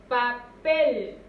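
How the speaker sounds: papel loud, split into "pa" and a strong "pel"; stress on final syllable. Shown as a demonstration. (papel fuerte, por sílabas, acentuando "pel")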